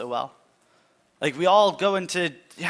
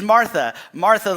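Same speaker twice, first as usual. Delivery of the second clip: higher, much louder repeated name; emphatic calling; 6 semitones higher, 8 dB louder than usual. Acted. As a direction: call her name out strongly. Jesus addressing Martha.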